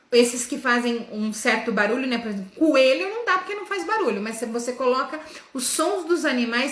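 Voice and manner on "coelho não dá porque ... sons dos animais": usual speaking voice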